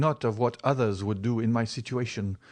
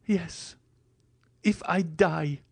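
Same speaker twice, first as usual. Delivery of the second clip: lightly voiced resigned girl; 8 semitones higher, slower than usual. Valentine resigned.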